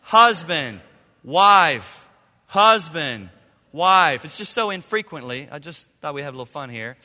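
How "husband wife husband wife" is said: "Husband" and "wife" called out loud; loud call and response. Shown as a demonstration. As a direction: lead the crowd in the chant. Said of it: "Let's say husband".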